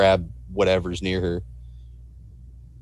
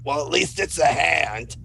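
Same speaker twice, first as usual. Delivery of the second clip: high-pitched character voice; in-character exclamation; 7 semitones higher than usual. Acted. Player voices Ethel seeing new hand.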